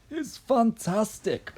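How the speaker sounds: high, drawn-out exclamation; excited shout. A narrator's reading. Mr Cranky marvelling at the hen, high excited shout. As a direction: shout it slowly with delight, pitched high. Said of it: "Mister Cranky shouted".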